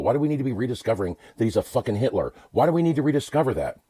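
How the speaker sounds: ordinary talk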